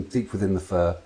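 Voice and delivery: plain reading voice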